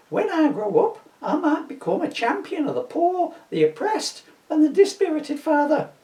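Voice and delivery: put-on high, young boy's voice; a posh boy asking his dad innocently.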